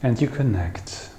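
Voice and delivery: soft, unhurried meditation voice with long pauses; guided meditation. Acted. Teacher guiding listeners to feel the breath, soft meditative voice. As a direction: guide them gently into the breath, leaving long silences.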